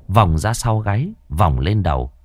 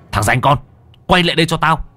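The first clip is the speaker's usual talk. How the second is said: shouted command; 8 semitones higher, 3 dB louder, faster than usual. Acted. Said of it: "Tiếng cậu quát".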